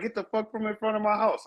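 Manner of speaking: imitating angry woman. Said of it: "her in her window, like".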